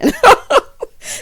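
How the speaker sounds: loud, high-pitched laugh; tickled high laughter; high cackle. Real, not acted. Laughs at own aside.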